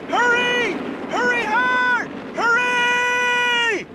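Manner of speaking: high yelling to sweep harder; curling skip yelling. Pretend. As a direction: shout Hurry hard like a curling skip.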